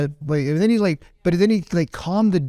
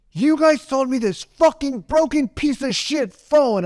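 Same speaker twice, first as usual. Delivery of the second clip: angry country guy; 10 semitones higher, slower than usual. Retold or imitated. Angry customer yelling at the phone store clerk. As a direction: rage at the clerk like a tough rancher. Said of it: "he's like".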